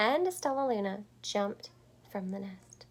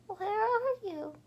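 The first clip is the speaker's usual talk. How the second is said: high, slow frightened little voice; lost baby bat; 12 semitones higher than usual. A narrator's reading. Baby bat alone on a branch calling for mother, high and slow. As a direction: call out small and scared.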